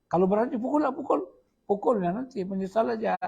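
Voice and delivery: high defiant hit me then; climbing pitch, daring him; retold wife daring him to strike; defiant dare.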